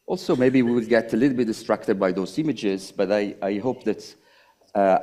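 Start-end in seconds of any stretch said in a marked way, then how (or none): none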